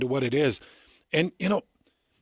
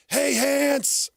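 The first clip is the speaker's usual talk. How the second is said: high calling-out shout; retold yell; 12 semitones higher than usual. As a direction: call across the field, pitched way up. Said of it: "Kalani would yell".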